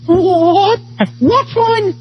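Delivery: high, rising shocked questions; shocked disbelief. Retold or imitated. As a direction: react in total disbelief. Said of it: "And we were like".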